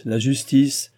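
outraged appeal to justice; s'écriant. A narrator's reading. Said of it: "s'écria la comtesse". (s'écrie avec indignation)

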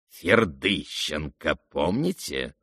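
name drawn out low; slow, low prompting. A narrator's reading. Ferdyshchenko asks if the prince remembers, slow and low. Stranger reintroduces himself to prince.

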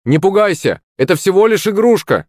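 high-pitched reassuring call. A narrator's reading. Hero telling Захарыч it's only a toy, calling out. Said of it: "крикнул я Захарычу".

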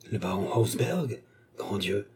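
slow, hushed astonishment; shocked exclamation. A narrator's reading. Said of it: "s'écria Yogi".

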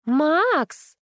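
high, drawn-out yell; straining call. A narrator's reading. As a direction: call him at a high pitch, insistently. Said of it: "надрывалась Капа".

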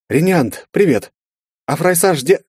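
high, bright greeting voice; a friendly girl popping in; cheerful young woman. A narrator's reading. Mari greets troll.

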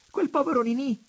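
very high, loud, amused exclamation; laughing outburst. A narrator's reading. Daughter mocking the poor suitor, laughing irresistibly. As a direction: blurt it high through a burst of laughter. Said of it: "con uno scatto di riso irresistibile".

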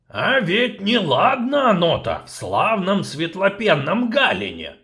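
barge in with grim news; gruff dwarf. Acted. Dwarf barging in on the sorceress, gruff and loud.

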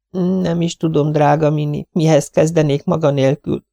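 high, gentle lady's voice; tired young wife. Acted. Mrs Tenius refusing food from Miss Lawson, high and polite. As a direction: decline politely, worn out.

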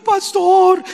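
high, loud mock wail; hammed-up crying plea; imitated tearful cry. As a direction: wail it like a distressed woman. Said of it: "la señora vino llorando".

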